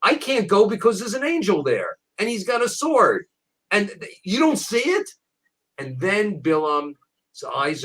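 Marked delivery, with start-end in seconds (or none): 0.0-5.1 a higher, lightly acted Bilam protesting; a hint of a panicked prophet, pitched up; mildly alarmed Bilam, higher voice, pleading with the angel; frightened Bilam. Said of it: "and he says".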